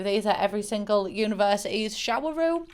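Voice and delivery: ordinary delivery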